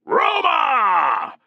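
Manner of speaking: high, loud chanted Roma; a roaring army cheer. Acted. Whole army cheering Roma, high and loud. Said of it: "the cheer was rolling off".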